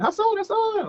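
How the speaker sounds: high-pitched imitation of excited kids screaming; thin, very high mock fan scream. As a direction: squeal the name like kids calling out, without full volume. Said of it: "like, screaming".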